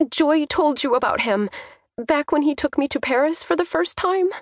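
high, tentative reminder; tentative daughter. A narrator's reading. Daughter gingerly reminds her father.

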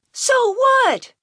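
high, loud yell back at a father; son's pent-up anger bursting out; defiant yell. A narrator's reading. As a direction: explode in defiance. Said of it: "he yelled at his father".

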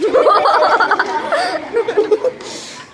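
high giggling laugh; high giggle. Real, not acted. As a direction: giggle at the punchline. Laugh at joke end.

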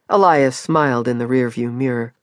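plain reading voice

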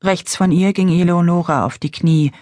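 ordinary delivery, nothing marked

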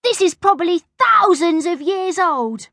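high-pitched schoolboy awe; acted childish excitement, pitched high; excited wonder. A narrator's reading. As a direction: marvel at an ancient find. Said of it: "said Bertie excitedly".

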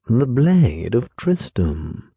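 ordinary delivery

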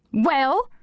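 sharp barked question. A narrator's reading. Kate barking at Anushka, sharp bark. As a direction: bark it like a drill sergeant. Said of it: "Kate barked".

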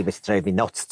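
ordinary talk, nothing marked

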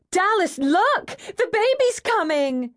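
very high, drawn-out excited squeal. A narrator's reading. Mavis to Dallas as a baby comes, excited squeal. Excited friend announces birth.

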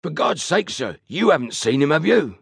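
high, rustic station master's plea; agitated station master. A narrator's reading. Station master asks Fenn about a missing motorman, agitated. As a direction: put on a high country voice, anxious.

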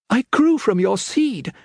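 put-on high, earnest young girl's voice; pleading daughter Faith. Faith pleads with her father.

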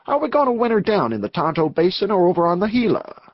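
questioning outlaw. Acted. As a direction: lift it into a hint of Western outlaw.

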